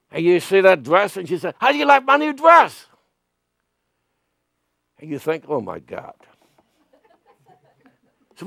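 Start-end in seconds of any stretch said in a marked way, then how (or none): 1.6-2.8 high, sing-song lady friend asking; high mock lady voice. Friend asks about dress. 5.5-6.1 muttered dismay. Retold or imitated. Inner thought seeing dress.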